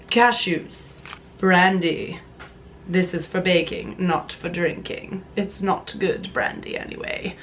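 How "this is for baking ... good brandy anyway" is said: odd hushed voice. Shown as a demonstration. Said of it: "Why am I talking like this?".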